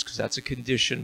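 usual speaking voice

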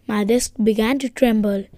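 plain reading voice

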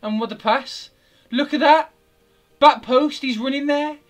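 high, loud indignant replay commentary; pissed-off ranting. Real, not acted. Gamer going over the replay of his missed goal, pissed-off ranting. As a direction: make your case in rising outrage. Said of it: "I'm so pissed".

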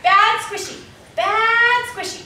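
high, loud mock scolding; Poseidon scolding. Poseidon stung by a jellyfish, high loud scolding. Replays Poseidon's line as cue.

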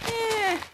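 "eh" long, very high and loud; noncommittal EH. Shown as a demonstration. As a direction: squeal out a stretched "eh" as a shrug. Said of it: "And I'll be like".

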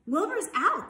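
high, loud announcement; excited news. A narrator's reading. Animals spread exciting news.

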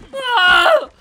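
very loud, high mock yell; imitated man yelling. Player imitating dinosaur's landing noise, loud man's yell. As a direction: mimic a yelling man at full volume. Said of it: "sound like a man yelling".

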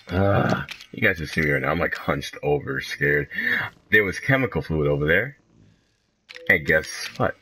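ordinary talk, nothing marked

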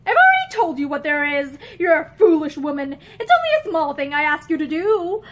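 loud, exasperated scolding of a foolish wife; scolding husband. Acted. Husband berating his wife over the letter, loud and cross. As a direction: lay down the law, loudly and impatiently.

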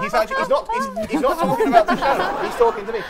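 high, loud, laughing "he's talking to me"; flustered laughing protest. Real, not acted. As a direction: squeal it out, embarrassed. Host mock-panics at innuendo.

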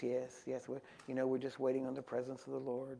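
quiet, understated murmur of a humble preacher; William Branham, soft-spoken. Retold or imitated. Humble preacher waiting on the Lord, soft-spoken. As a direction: keep it soft and unassuming. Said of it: "was just very soft-spoken".

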